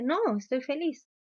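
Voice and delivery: alto y más fuerte, como un niño que se defiende; voz de niño insistente. Retold or imitated. Niño que insiste estar feliz. (niño insistente, voz aguda)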